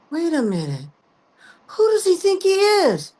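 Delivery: rising into high outraged disbelief; shocked realization. Retold or imitated. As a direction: suddenly realize what he meant, voice jumping high. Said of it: "I snapped out of it and I got real angry".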